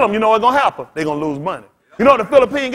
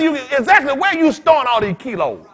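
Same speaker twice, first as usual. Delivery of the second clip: ranting shout; 4 semitones higher, 4 dB louder than usual. Real, not acted. Ranting at drug busts.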